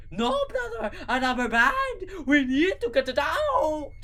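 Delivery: high panicked scream. Acted. Gru screaming at his brother to get it out. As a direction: shriek it out in a panic. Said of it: "screamed Gru".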